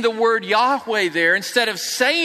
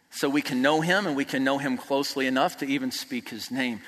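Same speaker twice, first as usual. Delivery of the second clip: much softer and lower, intimate; hushed reverence; 8 semitones lower, 6 dB quieter than usual. Real, not acted. Preaching to the congregation about Christ, hushed reverence. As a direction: drop to a near hush on his name.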